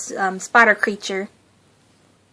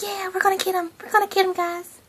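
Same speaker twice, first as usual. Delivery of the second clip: higher, softer, excited little-guy voice; eager little guy; 8 semitones higher, 6 dB quieter, faster than usual. Retold or imitated. Tiny Wesley cheering on the others, higher and eager. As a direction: squeak it out like a tiny eager minion. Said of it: "He's like".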